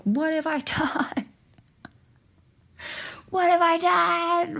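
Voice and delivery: high, slow, breaking wail of despair; retold crying wail. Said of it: "I'm on the ground and I'm crying".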